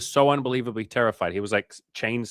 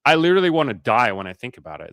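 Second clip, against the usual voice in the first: mock despair; 4 semitones higher, 8 dB louder than usual. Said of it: "You're like".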